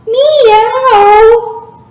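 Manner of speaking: high, loud drawn-out meow; cat meow. Shown as a demonstration. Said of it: "I wanna hear your cat sound".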